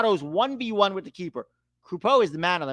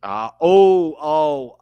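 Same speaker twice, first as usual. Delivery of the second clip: alarmed; 8 dB louder, much slower than usual. Real, not acted. Messi injury replay.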